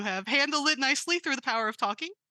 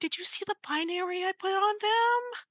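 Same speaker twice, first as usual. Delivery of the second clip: put-on giddy party girl; 8 semitones higher than usual. Robot gushing about nails.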